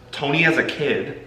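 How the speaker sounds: ordinary talk, nothing marked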